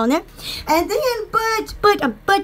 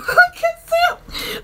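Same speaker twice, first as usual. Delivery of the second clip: excited other self; 13 semitones higher than usual. Retold or imitated. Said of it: "I'll go".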